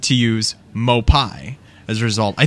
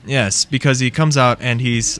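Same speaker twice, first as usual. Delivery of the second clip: normal talking voice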